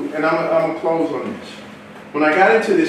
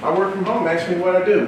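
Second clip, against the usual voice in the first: ordinary talk, nothing marked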